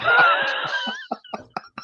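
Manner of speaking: high burst of laughter; amused high laughing; high-pitched laugh. Real, not acted. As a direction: crack up at the joke. Laughing at the Arsenal joke.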